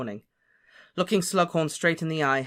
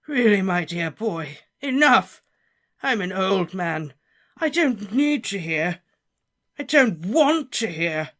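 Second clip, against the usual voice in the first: put-on higher, louder, slow pleading old man; distressed Slughorn; 6 semitones higher, 8 dB louder, slower than usual. Slughorn begging Harry not to go on. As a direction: beg the boy to stop, shaken.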